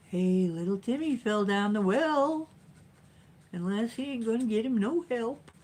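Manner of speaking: high, hammed-up alarm for little Timmy; mock concern. Vlogger jokes about Timmy down the well, mock concern.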